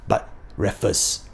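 unvoiced, slow mock-reverent whisper; hushed aside. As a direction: breathe the name like a secret. Hushed aside in comic praise.

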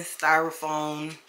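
usual speaking voice

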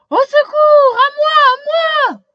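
very high, loud panicked cry; screaming for help. A narrator's reading. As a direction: shriek for help in a traffic jam. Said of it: "un policier hurle".

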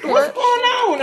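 high-pitched puzzled mock question; acted bewilderment. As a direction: act puzzled, voice lifted high. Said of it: "I'm looking around like".

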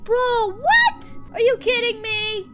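high-pitched, shrieked incredulity; outraged disbelief. Real, not acted. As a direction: squawk in shocked protest, very high. Outraged at the game ending.

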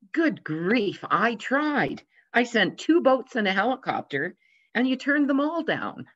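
a lightly played God, throwing up his hands in disbelief; exasperated God. God answers the preacher.

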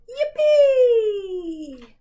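loud high excited cheer. Shown as a demonstration. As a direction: shout for joy at the top. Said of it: "Now we're very loud".

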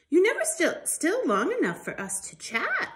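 a hint of Lucy calling to her friend; lightly voiced Lucy.

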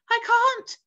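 high, loud helpless cry. A narrator's reading. James answering the earthworm as they fall, crying out. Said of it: "cried James".

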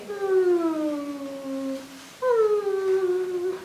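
long hummed "Hmm", sliding gently down; letter sound. Shown as a demonstration. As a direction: hum the M sound, drawn out. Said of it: "That's the sound M says".

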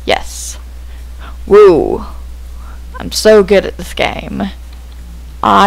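usual speaking voice

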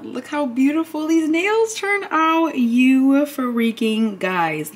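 high, slow, drawn-out delight; gushing. Real, not acted. Showing viewers her finished nails, slow high gushing. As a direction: stretch each word, in awe of the nails.

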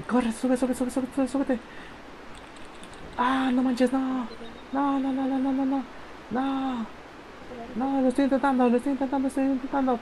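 high, tense pleading; frantic urging. Real, not acted. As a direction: beg the character to climb. Urging the character, then failing.

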